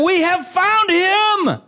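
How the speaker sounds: high, drawn-out triumphant cry; excited proclamation. Acted. Philip telling Nathanael they found him, excited proclamation. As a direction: draw it out slowly, pitched high, as great news.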